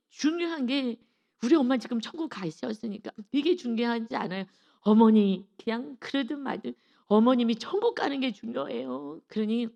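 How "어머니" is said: loud call to mother-in-law; a sudden loud call of 어머니. Retold or imitated. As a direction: call her loudly to get her attention. Calling out to mother-in-law emphatically.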